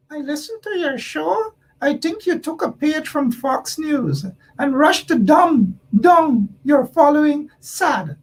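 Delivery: high, thin mocking imitation of a listener; Rafiq's voice. Host mocking a critical listener's comment in a high squeaky voice. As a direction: read the comment in a squeaky put-on voice. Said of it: "let me do it in Rafiq's voice".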